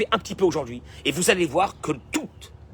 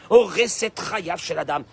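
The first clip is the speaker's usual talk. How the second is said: ordinary talk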